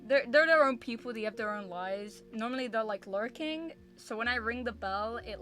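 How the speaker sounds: ordinary talk, nothing marked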